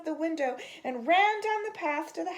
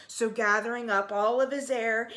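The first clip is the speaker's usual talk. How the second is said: plain reading voice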